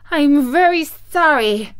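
put-on high, loud little-girl voice; frightened Dorothy, high and loud; frightened apology. A narrator's reading. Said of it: "who was truly frightened".

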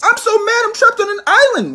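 very high, loud shrill rage; shrieking tantrum. Acted. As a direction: scream out the frustration. Shrieking tantrum about being trapped.

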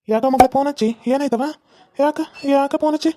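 father anxiously asking his daughter why she called; worried father. Acted. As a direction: press her, alarmed, for what is wrong.

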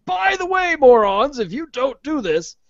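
high, loud sneering mock announcement; jeering mock warning aimed at the company; mocking sign voice. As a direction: shout the insult like a giant banner. Mock website warning to customers.

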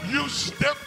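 ordinary talk

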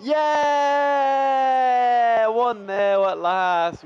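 very high, loud celebratory yell; long shout of joy, easing into slow relief; triumphant goal shout. Real, not acted. Gamer celebrating the opening goal at last, elated.

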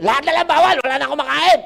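loud, high mock outburst; angry patient. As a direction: yell back like a fed-up patient. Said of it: "nagagalit pa kayo".

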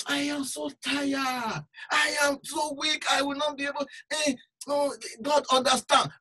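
mock the lazy brother's excuses; whining excuse. Mocking lazy believer's excuse.

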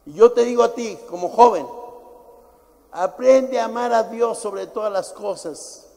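fiery pulpit exhortation; preaching shout. Real, not acted. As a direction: raise it up and drive it home. Preacher exhorting young people.

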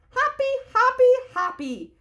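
high, loud happy croak; cheerful frog. Acted. Mister Frog answering the greeting, high and gleeful. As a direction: burst out like a delighted frog. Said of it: "How are you, Mister Frog".

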